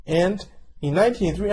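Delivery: ordinary delivery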